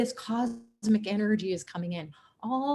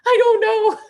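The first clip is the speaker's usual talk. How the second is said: laughing squeak; 14 semitones higher, 7 dB louder than usual. Retold or imitated. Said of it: "I'll say".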